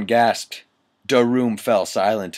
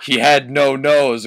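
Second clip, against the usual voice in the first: laughing; 3 semitones higher, 7 dB louder than usual. Real, not acted. Cracking up at the text.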